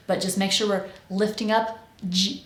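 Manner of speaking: normal talking voice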